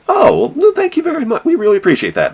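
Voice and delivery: falsetto thank-you, sweetly gracious; mock-gracious squeaky thanks; high sugary politeness; very high polite boss. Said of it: "And they're like".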